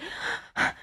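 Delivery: sharp, voiceless intake of breath; stunned gasp. Retold or imitated. Fan spotting her idols, a speechless gasp. Stunned on seeing the golf cart.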